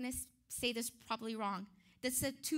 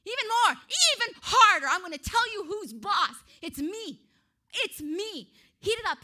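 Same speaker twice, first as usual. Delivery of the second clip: shouting orders, much higher and louder; furious king yelling; 6 semitones higher, 12 dB louder than usual. Acted. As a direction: roar the command to stoke the furnace. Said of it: "he responded by saying".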